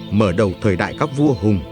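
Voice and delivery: plain reading voice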